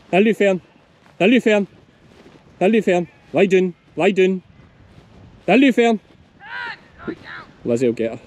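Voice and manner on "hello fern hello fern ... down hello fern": calling out. Real, not acted. Calling the dog across field.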